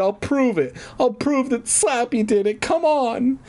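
high, urgent insistence; hammed-up high pleading; acted crying out. A narrator's reading. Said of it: "I cried".

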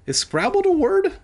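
scoffing disbelief; incredulous question. Real, not acted. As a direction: ask in disbelief. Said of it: "is that supposed to be scrambled".

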